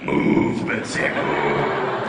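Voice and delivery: very slow, loud, very low mooing call; deep cow-like farmer. Acted. Trailer-voiced farmer calling his cow, mooing deep voice. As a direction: moo the words out in a huge deep voice. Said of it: "The cows would think that he's one of them".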